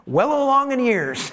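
careful, delicately lifted phrasing; tactful euphemism. Acted. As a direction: tiptoe around his wife's age. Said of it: "That's the way I said it".

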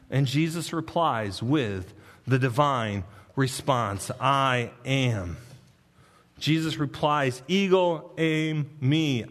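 low, hushed scripture voice; a preacher hushing for holy words; solemn reading. Acted.

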